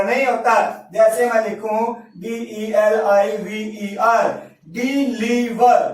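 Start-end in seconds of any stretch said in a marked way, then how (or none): 4.7-5.9 deliver slowly, in three separate pieces; split into syllables. Shown as a demonstration. Teacher counting syllables for students, slow three-part deliver. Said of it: "तीन हो गए".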